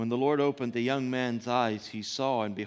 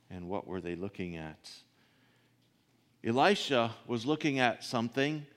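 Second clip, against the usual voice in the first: ordinary talk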